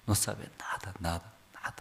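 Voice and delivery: hushed whisper trailing off; whispered repetition fading away. Acted. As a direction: breathe it out like a secret. Parents know nothing, hushed.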